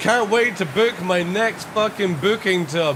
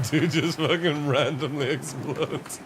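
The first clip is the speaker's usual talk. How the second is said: normal talking voice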